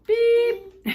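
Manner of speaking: high, loud beep with a fall; a little horn tooting; truck beep. Acted. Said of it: "Blue said".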